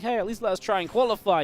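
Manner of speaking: pleading. Retold or imitated. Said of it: "a little bit of sadness".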